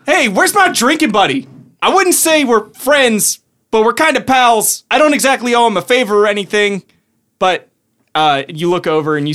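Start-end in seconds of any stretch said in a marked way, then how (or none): 0.1-6.8 high-pitched, chatty gnome voice; casual banter, pitched well up; gnome sorcerer. Acted. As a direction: pipe up as a little gnome sorcerer.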